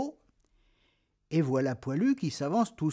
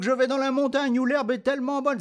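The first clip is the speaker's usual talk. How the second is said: high, fast goat voice; middle goat; 7 semitones higher, faster than usual. Acted. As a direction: rattle it off like a young goat. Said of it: "dit le moyen bouc".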